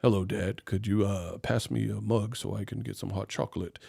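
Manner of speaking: put-on the toddler suddenly a refined gentleman; posh grown-up kid. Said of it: "the next day they're like".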